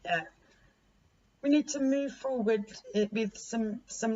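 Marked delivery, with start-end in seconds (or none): none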